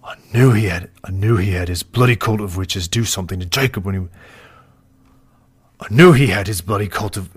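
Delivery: loud, forceful outburst; big dramatic accusation. Acted. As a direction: hit it hard, loud enough to peak. Voice actor records dramatic line, peaks mic.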